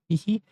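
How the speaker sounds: a little high-pitched giggle. Acted. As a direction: giggle up high like a pleased child. Finding soft toilet paper.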